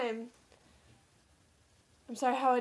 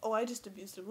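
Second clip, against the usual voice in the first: usual speaking voice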